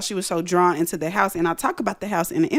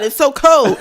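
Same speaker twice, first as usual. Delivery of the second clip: higher, much louder cry of cold; loud exclaim; 6 semitones higher, 8 dB louder than usual. Retold or imitated. James shocked by the icy can, loud cry. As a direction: shout it in disbelief. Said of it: "he was like".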